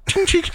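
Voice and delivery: high imitated intercom chime; ping it like a cabin chime; mock chime sound. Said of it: "he just goes".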